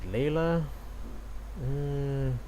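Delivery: ordinary talk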